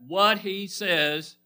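very slow, deliberate repeat; weighty preacher's lead-in; insistent prompt. Real, not acted. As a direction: stretch each word to draw an answer. Repeats prompt after weak response.

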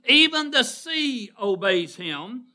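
loud, high and slow proclamation; ringing declaration of authority; emphatic preaching. Real, not acted. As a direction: stretch it out with pulpit force. Preacher stresses Christ's authority.

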